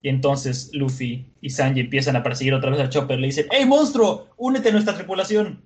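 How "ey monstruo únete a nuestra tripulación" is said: high, loud acted shout; imitated excited recruiting call. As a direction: holler eagerly after someone running away. Luffy calls after fleeing Chopper.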